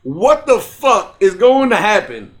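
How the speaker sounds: ordinary delivery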